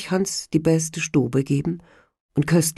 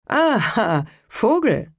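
gloating soldier; 7 semitones higher, slower than usual. Acted. Soldat gloats over sergeant.

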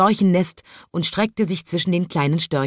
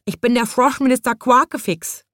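high pleading little frog; 7 semitones higher than usual. A narrator's reading. Frog minister Quakefix begs, high frog voice. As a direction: announce yourself as a desperate frog. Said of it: "bat er".

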